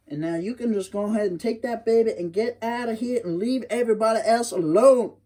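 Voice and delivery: sassy mother sending him off; Wiley's mammy. Acted. Mammy dismisses hairy man.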